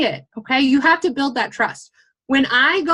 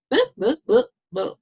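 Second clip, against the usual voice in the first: boop sounds; 3 semitones higher than usual. Pretend. Mock ad sound effect.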